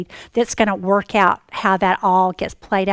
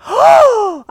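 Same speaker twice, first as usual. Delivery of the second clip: much higher, louder shocked "Oh!"; startled cry; 13 semitones higher, 13 dB louder than usual. Retold or imitated. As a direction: blurt it out in amazement. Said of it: "And I just went".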